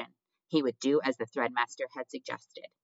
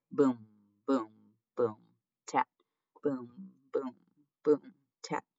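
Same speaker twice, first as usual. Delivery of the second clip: ominous drumbeat; slower than usual. A narrator's reading. Narrator imitating the mice's drum, ominous beat. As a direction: beat out each word like a drum. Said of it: "produced an ominous sound that went something like".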